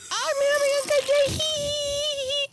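put-on very high, giddy little-kid voice; young boy's squeal. Storyteller as his young self riding home, giddy squeal. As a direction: squeal it like an excited small child. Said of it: "As a really young boy".

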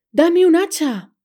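high, loud, slow call; shouting. A narrator's reading. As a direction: yell urgently down to mother. Jack calls to his mother.

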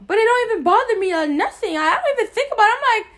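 high, loud heated insistence; exasperated and loud; worked up. Real, not acted. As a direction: insist it's behind her, loudly. Heated venting about being over it.

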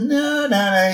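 high, pleading no no no; a light Homer voice. Retold or imitated. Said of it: "he says".

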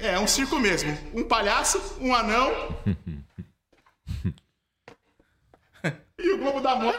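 hammy clown boasting with giggles. Acted. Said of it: "Como Palhaço Amendoim".

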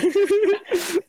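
loud high-pitched laugh; cracking up. Real, not acted. Laughing at own joke.

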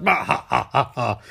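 spelled-out evil "bah ha ha"; hammed-up staccato cackle; mock villain laugh. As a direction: fake a gloating villain's laugh. Villain gloats after kill.

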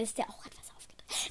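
whispered confidentially; whispered aside. Real, not acted. Host whispering a secret to her listeners. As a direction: let it slip in a whisper.

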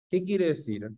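ordinary talk, nothing marked

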